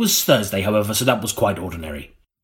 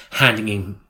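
normal talking voice

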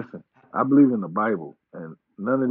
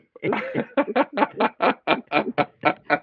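high laughter; 12 semitones higher than usual. Real, not acted. Guest repeating his Avengers joke, bursting into high laughter. Laughs again at own punchline.

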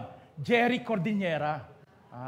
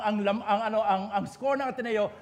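ordinary talk, nothing marked

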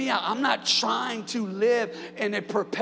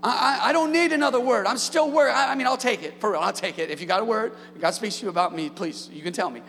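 full-force preaching; 3 semitones higher, 4 dB louder than usual. Acted. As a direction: proclaim it to the congregation with fire.

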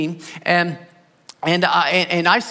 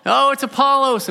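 hammed-up eager fans gushing; mock fan voices; 6 semitones higher than usual. Corinthian believers cheering their favourite preacher, mock eager and higher. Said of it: "where they're like".

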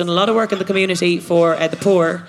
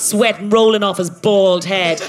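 normal talking voice

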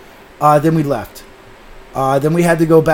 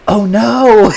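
mock dismayed cry; 4 semitones higher, 6 dB louder than usual. Woman reacts to spilled drink.

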